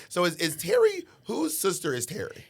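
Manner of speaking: ordinary delivery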